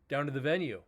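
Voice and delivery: ordinary talk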